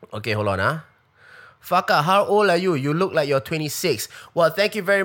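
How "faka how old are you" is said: ordinary delivery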